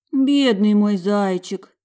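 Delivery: tender pity. A narrator's reading. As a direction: lament over the poor child. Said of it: "качала головой Маржа".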